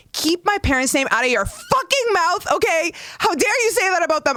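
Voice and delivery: high, furious, fast rant; outraged kid. Retold or imitated. Child scolding classmates in high outrage. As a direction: defend your parents, outraged. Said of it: "I was so mad".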